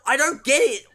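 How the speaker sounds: high-pitched plea for an explanation; exasperated bafflement. Real, not acted. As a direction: demand answers in comic frustration. Mock demand at a confusing film.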